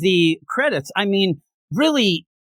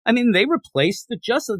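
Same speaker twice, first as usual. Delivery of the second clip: ordinary talk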